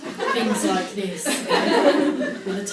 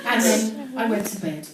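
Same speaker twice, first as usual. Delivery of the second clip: usual speaking voice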